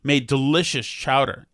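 usual speaking voice

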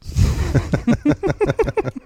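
real giggling laugh; amused run of laughter. Real, not acted. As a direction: burst into a chuckle. Laughs at own self-deprecation.

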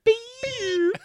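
high, squeaky imitated goggles beep. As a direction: squeak the night-vision goggles switching on. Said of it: "Splinter Cell impersonation of him turning his goggles on".

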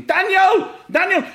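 high, loud shout of the name. Retold or imitated. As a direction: yell out to his kid across the pitch. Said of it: "So he's running and spraying, shouting".

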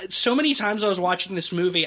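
ordinary delivery

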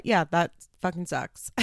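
usual speaking voice

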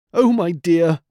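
a hint of a sob, mildly acted; sobbing. A narrator's reading. Mother pleading tearfully with her husband, mild sob. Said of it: "sobbed Missus Nutcracker".

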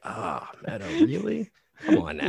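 a hint of Tony sighing, then a high squeaky really; disappointed dad. Mimicking Tony letting Meadow down gently, mildly disappointed.

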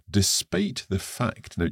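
usual speaking voice

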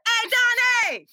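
high, loud street voice mocking Donnie.

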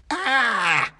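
high-pitched scream of alarm; shouted cry. A narrator's reading. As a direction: drunk pudding's shrieked "ah". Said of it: "shouted the pudding".

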